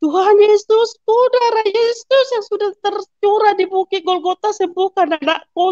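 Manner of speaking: high, urgent reenacted prayer cry; retold crying out in prayer. Mother prays for her sick child, crying out as retold. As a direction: plead aloud to Jesus, pitched well up. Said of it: "langsung saya teriak".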